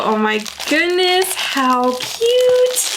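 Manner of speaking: high squeal rising on "cute"; real delight climbing into a squeak; delighted gushing. Real, not acted. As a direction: gush over an adorable gift. Opening a gift on camera.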